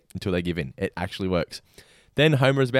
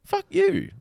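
defiant retort. Retold or imitated. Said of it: "I'm like".

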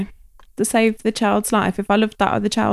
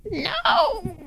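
imitating crying child; 13 semitones higher, 5 dB quieter than usual. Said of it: "woke up in the night crying and being like".

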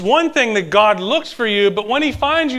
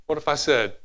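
normal talking voice

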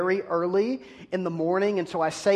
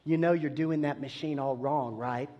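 woman whispering close; 4 semitones lower, 6 dB quieter than usual. Retold or imitated. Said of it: "the voice of the woman in my ear says".

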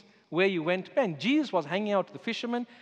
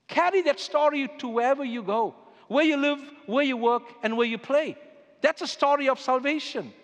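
rising preaching call; 5 semitones higher, 4 dB louder than usual. Acted. Preacher sending the congregation out, rousing call. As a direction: lift it higher and louder, each phrase a push.

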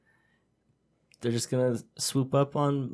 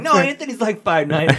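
much higher, louder insistent protest; mock defending a friend; 13 semitones higher, 7 dB louder than usual. Host retelling how he defended Anthony, high insistent protest. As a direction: insist on it a bit too hard.

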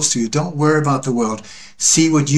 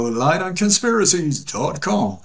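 higher, swooping American announcer-style accent; put-on American voice; 5 semitones higher than usual. Shown as a demonstration. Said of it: "That's my American voice".

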